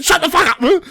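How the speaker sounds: high, loud ranting imitation; mock angry outburst. Cousin denying his family owns New Zealand rugby, mock-furious shouting. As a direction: shout it like a hammed-up, exasperated cousin.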